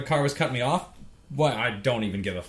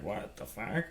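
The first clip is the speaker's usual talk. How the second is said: stunned reaction; 3 semitones lower, 10 dB quieter, slower than usual. Retold or imitated. Retold stunned reaction after crash.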